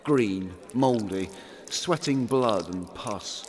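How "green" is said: usual speaking voice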